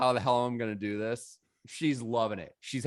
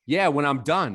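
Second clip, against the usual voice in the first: louder, higher brush-off; mock defiant; 3 semitones higher, 6 dB louder than usual. DJ refusing to give up the booth, mock defiant. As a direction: imitate a stubborn refusal, raised voice.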